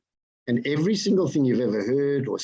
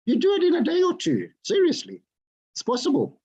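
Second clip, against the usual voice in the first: excited; 11 semitones higher than usual. Real, not acted. Pitching to the room.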